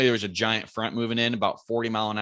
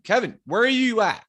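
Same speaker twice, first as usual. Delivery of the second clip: high, drawn-up holler to a far-off friend; retold calling out; 13 semitones higher, 4 dB louder than usual. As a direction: re-enact calling up the hill. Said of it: "We're yelling".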